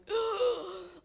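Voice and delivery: loud, high imitated waking gasp; startled gasp. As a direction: gasp sharply as if jolted out of sleep. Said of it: "I wake up going".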